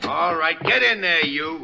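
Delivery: gruff command to a prisoner; rough order. Acted. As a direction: shove him in with your voice. Shoving a prisoner inside.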